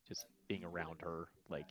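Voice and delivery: ordinary delivery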